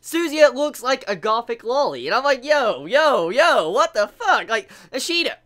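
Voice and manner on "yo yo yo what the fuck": louder, drawn-out yo, yo, yo of disbelief; loud shocked outburst. Retold or imitated. Fan reacting to a manga panel, loud shocked disbelief. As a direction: belt it out like you can't believe the page.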